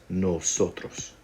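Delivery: nosotros stretched out slow and odd-sounding; weird slowed word. Shown as a demonstration. Teacher introducing nosotros, slow and oddly warped. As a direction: drag out "nosotros" strangely slow. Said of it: "Whoa, that one got weird".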